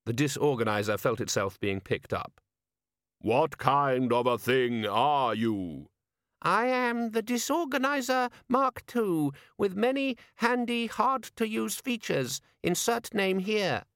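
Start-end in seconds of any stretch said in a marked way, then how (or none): 3.2-5.9 slow, drawn-out character voice; ponderous, unearthly questioner; ominous stranger. A narrator's reading. Mysterious stranger questions the demon. 6.4-13.9 very high, slow sing-song recital; high-pitched imp reading its sales patter; eager gadget demon. A narrator's reading. Demon organiser voiced in story.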